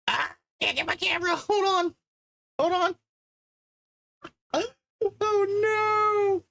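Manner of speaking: real alarm in a squeal as the camera setup goes wrong; panicked squeals. Real, not acted. Streamer panicking over broken camera, high panicked squeal.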